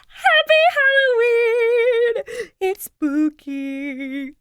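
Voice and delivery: high, slow, drawn-out ghostly wail; hammed-up spooky greeting; spooky Halloween voice. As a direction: croon it like a cartoon ghost.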